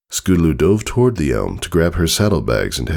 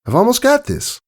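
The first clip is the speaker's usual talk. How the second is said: put-on much higher young filly voice; Scootaloo's voice. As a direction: raise the voice high like a young pony.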